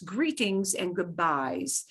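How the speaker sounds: ordinary delivery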